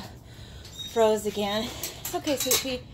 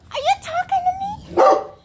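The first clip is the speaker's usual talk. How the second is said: hondjespraat; 10 dB louder than usual. Real, not acted. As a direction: squeal it playfully at the dog, much higher. Talking to the dog.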